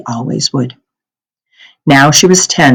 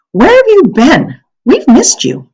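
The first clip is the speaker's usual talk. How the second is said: put-on high, eager little girl voice; girlish high-pitched question; young girl; 11 semitones higher, 4 dB louder than usual. A narrator's reading. Child voice of Anne-Marie.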